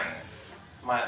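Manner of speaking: ordinary talk, nothing marked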